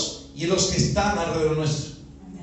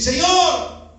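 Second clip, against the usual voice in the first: raised, higher cry of appeal; calling out; 6 semitones higher, 4 dB louder than usual. Acted. Believer alone at dawn calling the Lord, raised cry. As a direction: call up to God for attention.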